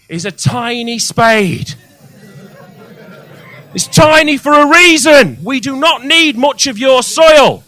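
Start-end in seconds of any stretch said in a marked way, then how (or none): 3.7-7.6 much higher and louder, slowed, scolding; exasperated shout. Real, not acted. Comic scolding patients who overfill pots, exasperated shout. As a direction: hammer home the point, exasperated.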